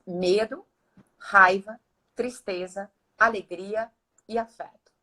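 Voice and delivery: ordinary talk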